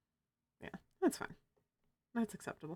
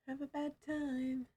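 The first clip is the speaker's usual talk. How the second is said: menacing game voice; 8 semitones higher, 6 dB quieter than usual. Acted. Sans quote in put-on voice.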